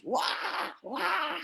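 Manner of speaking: pekik tiruan tinggi, tidak keras; tiruan teriakan. Retold or imitated. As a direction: menirukan orang berteriak dengan suara tertahan. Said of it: "Teriak-teriak terus". (tiruan teriakan bernada tinggi)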